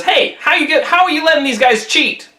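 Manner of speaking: high, indignant protest; indignant challenge. A narrator's reading. Monkey confronting the Dragon King, high and indignant.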